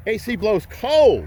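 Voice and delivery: high, loud, slow and proud; excited shout. Real, not acted. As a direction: belt it out like a salesman's boast. Dealer touting car feature.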